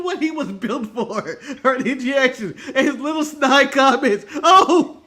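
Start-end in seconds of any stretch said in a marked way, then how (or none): 4.4-5.0 loud, high exclamation; an imitated over-the-top commentator's reaction; Jerry the King Lawler. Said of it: "We need all his old reactions".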